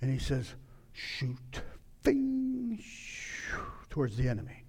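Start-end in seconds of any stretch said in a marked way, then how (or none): 0.9-1.6 hushed, voiceless order; whispered command. Acted. Same command replayed in a hush. 2.0-3.6 high ringing twang, then soft whoosh; bowstring ping and airy flight sound; imitated arrow shot. Imitates arrow leaving the bow.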